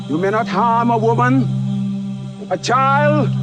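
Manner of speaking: usual speaking voice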